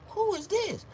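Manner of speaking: high put-on woman's voice; mock woman. Woman not recognizing him. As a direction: ask it as the surprised girl. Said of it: "she was like".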